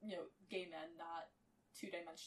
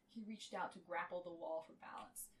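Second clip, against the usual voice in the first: usual speaking voice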